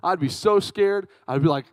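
usual speaking voice

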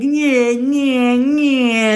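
high, drawn-out wordless whine; hammed-up high whining noises; mock whining. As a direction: whinge loosely in imitation of your drunk self. Imitating own whining in the audio.

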